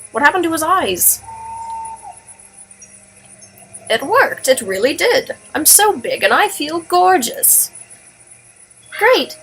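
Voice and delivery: rally everyone to go; excited girl voice. Acted. Fran amazed the toad grew, excited girl voice.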